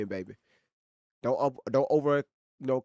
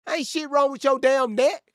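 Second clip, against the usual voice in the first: higher, louder, dismissive; loud scold; 11 semitones higher, 6 dB louder than usual. Real, not acted. Streamer scolding a chatter about his neck, loud and high. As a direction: shut the excuse down hard.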